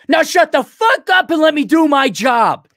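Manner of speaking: sudden high furious shout; Les Grossman outburst. Retold or imitated. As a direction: snap from fake politeness into a roaring yell. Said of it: "the Les Grossman quote from Tropic Thunder".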